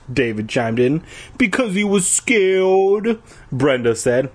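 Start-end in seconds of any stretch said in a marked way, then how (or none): 1.4-3.2 put-on higher girlish voice. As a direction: voice a mean girl, pitched up. Voicing Brenda in story.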